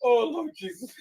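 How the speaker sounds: high, crying-laughing gasps; laughing to tears. Real, not acted. Host recovering from a laughing fit, tearful. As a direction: wheeze out through tears of laughter.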